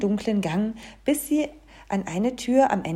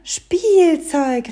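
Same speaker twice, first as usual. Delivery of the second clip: high, loud exclamation; excited cry; 8 semitones higher, 9 dB louder than usual. A narrator's reading. Fairy tale spots the toys, high loud cry. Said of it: "rief das Märchen".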